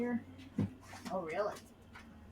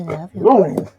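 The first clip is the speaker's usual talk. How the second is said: "Love you." belted out much louder; 4 semitones lower, 15 dB louder than usual. Shown as a demonstration. Owner calling "Love you." loudly to the dog. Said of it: "I say".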